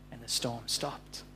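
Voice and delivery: low, very soft storytelling; hushed narration. A narrator's reading. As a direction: drop to a hush as the storm ends. Storm dies down in story.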